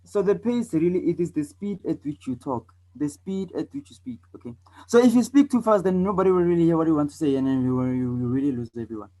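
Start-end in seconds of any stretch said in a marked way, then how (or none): 4.9-9.1 hurried, run-together demonstration; speaking too fast. Shown as a demonstration. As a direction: race through it without a breath. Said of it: "Did you hear what I just said?".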